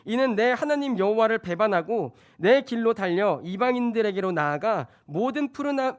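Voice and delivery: loud, declarative scripture reading. Acted. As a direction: proclaim the verse to the congregation. Reads scripture to congregation. (큰 소리로 성경 봉독)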